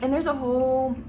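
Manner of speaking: chewing while talking; words round a bite of food; mouth full. Real, not acted. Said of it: "Sorry I'm eating with my mouth full".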